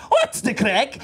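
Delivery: lightly imitated African accent. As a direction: put on a cheerful African taxi driver's accent. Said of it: "African lad's looking over the shoulder going".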